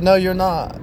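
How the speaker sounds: high, loud mock denial; wife contradicting him, high mock denial; imitating wife. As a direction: snap it back high as the wife. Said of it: "She said".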